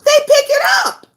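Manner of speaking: high, loud insistence; emphatic shout. Real, not acted. Warning parents about kids copying, shouted. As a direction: drive the point home hard.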